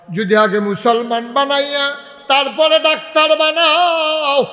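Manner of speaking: high ringing sermon cry; full-force preaching. Acted. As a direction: belt it out to the crowd, stretching the phrases.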